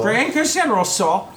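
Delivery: high NPC shopkeeper voice. Acted. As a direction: play the chirpy shop lady.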